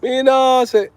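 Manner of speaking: high, loud Jamaican patois voice; Jamaican accent. Pretend. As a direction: play a hyped Jamaican youth. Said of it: "when I go to Jamaica".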